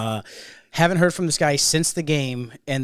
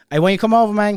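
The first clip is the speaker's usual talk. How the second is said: put-on Memphis accent; 7 semitones higher, 10 dB louder than usual. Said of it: "He got a fucking accent".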